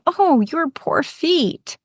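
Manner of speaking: high, slow moan echoing her; sympathetic moan. Acted. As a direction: moan right back in sympathy. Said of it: "They moan".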